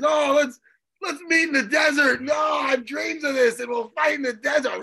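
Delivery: hammed-up weird, strained shouting; weird fighter's rant. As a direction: imitate an overwrought fighter calling someone out. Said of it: "He gets all weird with it".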